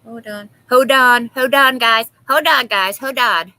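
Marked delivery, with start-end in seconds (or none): 0.7-1.3 loud, high call of Hold on. Real, not acted. Same words shouted. 1.3-3.5 loud repeated call to the room. Real, not acted. Calling out to chat, loud.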